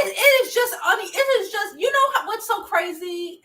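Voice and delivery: high, pleading disbelief; exasperated disbelief. Real, not acted. Viewer begging someone to explain it, high and exasperated. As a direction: plead for it to make sense.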